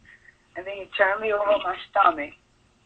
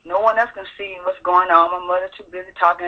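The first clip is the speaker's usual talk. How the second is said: ordinary talk, nothing marked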